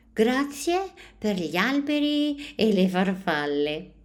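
put-on a small boy proudly saying thanks; happy child voice. A narrator's reading. Joe shows his colourful thanks, high and proud.

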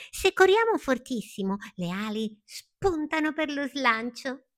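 high, excited rush; eager caterpillar. A narrator's reading. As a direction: race into the plan in a high little voice. Palmira eagerly explains her plan.

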